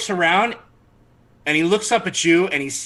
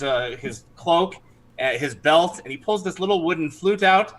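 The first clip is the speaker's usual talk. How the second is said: usual speaking voice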